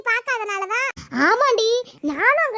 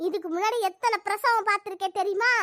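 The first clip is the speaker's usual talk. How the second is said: ordinary delivery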